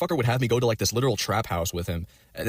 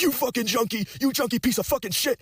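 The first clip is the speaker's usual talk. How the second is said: retold angry scream. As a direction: mimic a furious yell way up high at normal volume. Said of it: "he was screaming at me".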